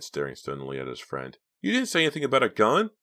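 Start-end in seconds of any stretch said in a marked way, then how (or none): 1.6-2.9 high, loud, fast protest; crying out. A narrator's reading. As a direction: blurt out a startled accusation, pitched way up. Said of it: "Mickey cried".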